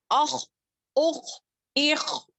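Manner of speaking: اخ said slowly with the breath held in. Shown as a demonstration. Teacher showing that the breath stays in on اخ. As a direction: drag out a heavy kh. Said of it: "دیکھیں".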